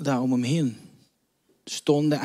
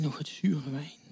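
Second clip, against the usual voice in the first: whisper; 8 dB quieter than usual. A narrator's reading. Hushed narration.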